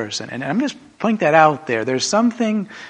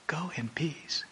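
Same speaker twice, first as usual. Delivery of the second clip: whispered echo; 15 dB quieter, slower than usual. Acted. Preacher echoes Christ's words softly.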